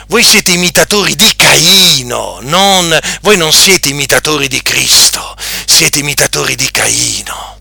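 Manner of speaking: ordinary delivery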